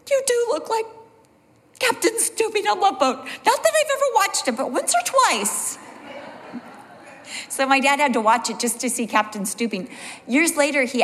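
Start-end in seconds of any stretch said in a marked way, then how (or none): 0.1-5.8 imitating mom in a much higher voice; mother teasing dad, voiced much higher; mom's voice. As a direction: pitch way up to play his mother teasing.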